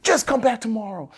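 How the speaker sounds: half-acted scolding from the recruiter; snapping impatiently. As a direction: cut him off, fed up. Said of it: "she snapped at me".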